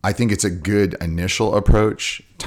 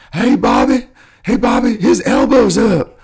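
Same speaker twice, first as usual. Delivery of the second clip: high, loud, slow Southern drawl yelling; mock Southern coach yelling instructions; Southern coach accent; 8 dB louder, slower than usual. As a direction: call to Bobby like a Southern coach. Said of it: "forgive my Southern accent".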